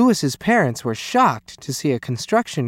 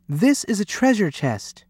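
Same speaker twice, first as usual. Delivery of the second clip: raised, excited cry of discovery; cried out; 6 semitones higher than usual. A narrator's reading. Louis spotting treasure from the boat, crying out. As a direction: announce the find in a higher voice. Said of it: "cried Louis".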